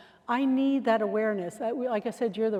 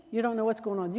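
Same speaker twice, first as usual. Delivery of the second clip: ordinary talk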